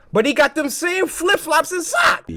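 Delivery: high-pitched, climbing disbelief; incredulous recognition. Real, not acted. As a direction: build to a high squeal of recognition. Sudden recognition while watching story.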